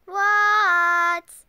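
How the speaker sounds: loud sung reply from far away; loud sing-song answer; calling back. Acted. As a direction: shout it back, stretched out. Froggy answers calling father.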